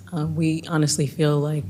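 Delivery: usual speaking voice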